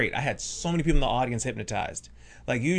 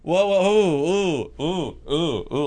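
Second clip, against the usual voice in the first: high, loud whoas tapering into oohs; mock scandalized hooting that gradually settles; imitated heckler; 8 semitones higher, 9 dB louder, much slower than usual. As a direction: imitate an audience member loudly objecting. Acting out a girl objecting.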